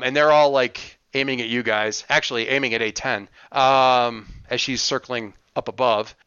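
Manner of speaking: usual speaking voice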